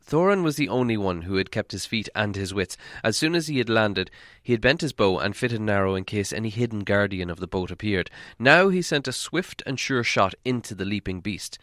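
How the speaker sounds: ordinary delivery, nothing marked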